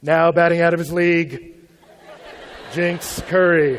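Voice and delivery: hint of a ballpark announcer; baseball announcer. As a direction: announce him like a ballplayer at bat. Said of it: "dropped into a batter's stance".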